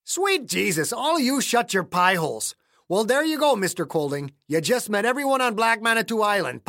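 put-on irritated accented old-timer; annoyed old man. A narrator's reading. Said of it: "shook his head in annoyance".

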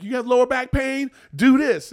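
loud, high, punchy imitation of an online doctor; TikTok doctor. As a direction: pitch it loudly like a video demo.

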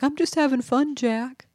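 whining Bubba imitation. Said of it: "And Bubba's like".

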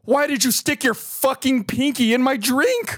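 high-pitched, slow, exasperated demand; retold outrage. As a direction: re-enact indignant fury, stretching each word. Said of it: "like, anger and fury".

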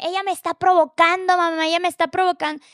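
high, loud mock accusation; imitated yelling. Son yells to his mother that he is provoked, imitated shouting. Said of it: "empieza a gritarme".

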